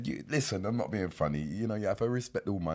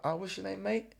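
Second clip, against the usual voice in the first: casually; 7 semitones higher, 5 dB quieter than usual. Retold or imitated. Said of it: "Like, casually".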